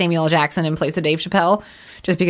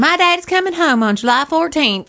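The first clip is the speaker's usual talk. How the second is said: put-on high, girlish quote voice; mock little girl voice; 8 semitones higher than usual. Mocking a girl's movie quote.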